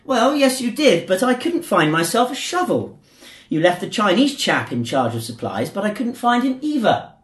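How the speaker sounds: a hint of a polite English gent; English accent. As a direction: joke teller voicing an Englishman in a light English accent. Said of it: "the Englishman replied".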